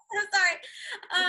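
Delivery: "So sorry" stretched slowly with a laugh; drawn-out apology. Retold or imitated. Declining the cheese counter job, slow laughing apology. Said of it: "So I was like".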